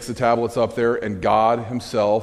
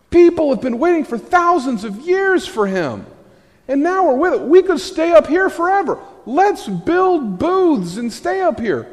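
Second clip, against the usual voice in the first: giddy excitement; 4 dB louder than usual. Retold or imitated. Voicing the disciples' thrilled thoughts.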